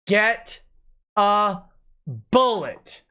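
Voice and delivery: "Get a bullet" with a long pause before each word. Real, not acted. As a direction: spell out "Get ... a ... bullet" slowly, one word at a time. Repeats threat, then spells it out.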